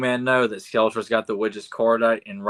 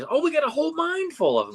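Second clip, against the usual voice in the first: high-pitched voice of an eager show-off; high, excited little-guy voice; eager boasting character; faster than usual. Retold or imitated. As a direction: brag excitedly in a high cartoonish voice. Acting out Squinch boasting to her.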